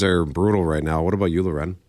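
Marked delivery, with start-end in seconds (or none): none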